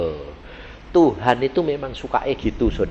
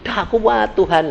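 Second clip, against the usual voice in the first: mock complaint to God, starting higher; imitated grumbling; 9 semitones higher than usual. Believer complains to God under trial, imitated grumbling.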